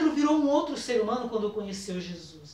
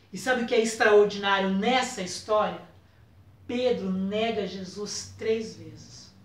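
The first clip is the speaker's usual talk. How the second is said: normal talking voice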